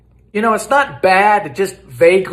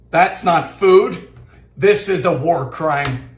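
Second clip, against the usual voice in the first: ordinary delivery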